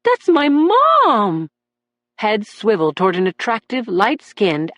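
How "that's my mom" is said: high, loud and drawn out in amazement; calling out in startled wonder; excited cry. A narrator's reading. Son spotting his mother in court, amazed and loud.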